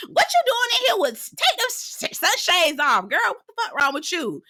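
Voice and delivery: high-pitched imitation of Tisha snapping; mock Tisha. Tisha snapping at Mel about her shades, high mock voice. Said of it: "Here go Tisha".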